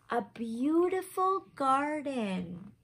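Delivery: plain reading voice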